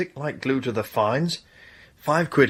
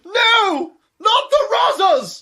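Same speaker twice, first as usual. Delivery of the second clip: high, loud cry of alarm; panicked shout; 5 dB louder, slower than usual. Acted. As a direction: shout it in horror at the police. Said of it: "Don't shout like that".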